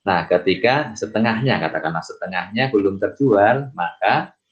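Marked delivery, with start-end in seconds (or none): none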